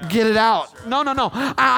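ordinary delivery

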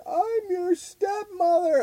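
very high, slow, wailing put-on cry; mock sobbing stepmother. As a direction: wail it like a crying stranger on the floor. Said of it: "crying, going".

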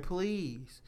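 hushed imitation of a moaned plea; mock moaning. Man retelling a partner's plea, mock moaning. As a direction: plead very softly, imitating moans. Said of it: "she was moaning and shit".

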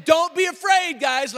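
very loud, high commanding holler; army angel shout. Acted. Army angels in camouflage to shepherds, commanding shout. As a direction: bellow it like a soldier to frightened men. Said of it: "It was the angels of the Lord's army".

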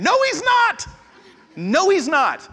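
high, loud no, he's not; emphatic denial. Acted. Pastor rejects the self-made man, loud and high. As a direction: knock the myth down hard.

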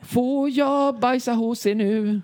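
put-on high, slow childish whine; whiny plea. Asking strangers to use their toilet, high slow whining. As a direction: beg like a small child.

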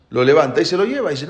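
ordinary talk, nothing marked

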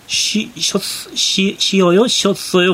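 halting beginner Korean. Retold or imitated. Husband imitating his Japanese wife confused by past tense, haltingly. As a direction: imitate a learner testing past tense with false starts. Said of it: "띄엄띄엄".

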